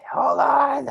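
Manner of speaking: loud, high creature voice; creature's call. Acted. Mock griffin tells traveler to wait, high squawk. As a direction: squawk it down from above.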